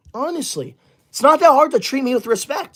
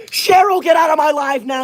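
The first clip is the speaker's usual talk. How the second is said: angry shout; 5 semitones higher than usual. Real, not acted. Streamer yelling at a commenter to get out, angry. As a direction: shout her out of the chat.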